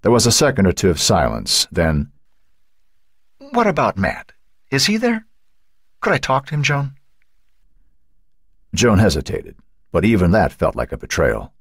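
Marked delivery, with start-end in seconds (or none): 3.4-6.9 high, tentative worried mother; worried pleading on the phone; anxious questioning. A narrator's reading. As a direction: press gently for an answer, voice pitched up.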